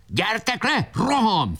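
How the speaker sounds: shouted while running. A narrator's reading. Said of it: "felelt futtában".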